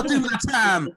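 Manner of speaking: mock gangster bravado. Comic shrugs off prison, mock defiant. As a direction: boast like a pretend convict. Playing hardened criminal for laughs.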